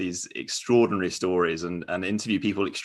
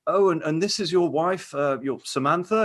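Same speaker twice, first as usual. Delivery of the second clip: Louis asking; 8 semitones higher than usual. Retold or imitated. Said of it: "he'll sort of say".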